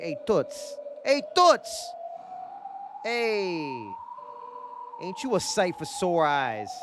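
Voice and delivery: put-on old-timey tough guy, slow at the end; 1920s goon voice.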